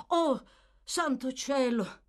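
high, absent-minded surprise; innocent exclamation. Acted. Absent-minded old gentleman remembers the unpaid book, innocently exclaiming. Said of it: "esclamò nel tono dell'innocenza".

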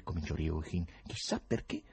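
ordinary delivery

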